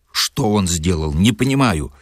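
ordinary delivery, nothing marked

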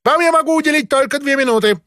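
high, fast, haughty official; curt high-pitched brush-off; peacock voice. A narrator's reading. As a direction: rattle it off high like a vain peacock. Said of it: "сказал он павлиньим голосом".

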